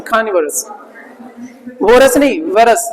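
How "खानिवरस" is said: खानिवरस with an aspirated kh at the start; खानिवरस, the k said with a puff of h; breathy kh start. Shown as a demonstration. Teacher: खानिवरस, क को ह के साथ. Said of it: "थोड़ा ह के साथ में".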